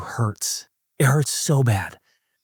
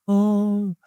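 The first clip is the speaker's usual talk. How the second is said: mock whining; 9 semitones higher, much slower than usual. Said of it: "you start whining".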